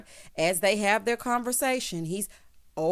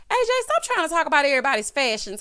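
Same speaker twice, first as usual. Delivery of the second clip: loud, quick, higher-pitched telling-off; mock scolding; 6 semitones higher, 6 dB louder, faster than usual. Host telling A J off about fashion, mock scolding. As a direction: tell him off fast and loud.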